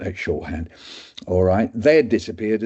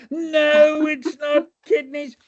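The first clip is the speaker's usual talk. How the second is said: much higher, loud no, then slow; exasperated shout; 4 dB louder, much slower than usual. Retold or imitated. Doctor rejects students' answer, high shout. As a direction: bellow the no then drag it out.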